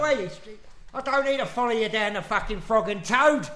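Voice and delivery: high-pitched, comic Cockney voice; Cockney shark. Acted. Shark berating the swimmer it follows, Cockney shark voice. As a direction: play an aggrieved Cockney shark. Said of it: "Yeah, it's a Cockney shark".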